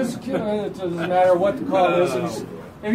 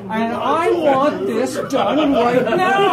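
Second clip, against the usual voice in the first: slow, louder mock-stern order; a light impression of Billy scolding the coach; Billy lecturing; 4 semitones higher, 6 dB louder, slower than usual. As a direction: lay down the law like the fussy ref. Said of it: "you know how Billy goes".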